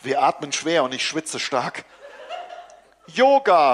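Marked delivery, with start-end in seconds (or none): 3.1-3.7 loud call through the door. A narrator's reading. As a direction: shout one word to cover up. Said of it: "ruft Mareike". (laut gerufen)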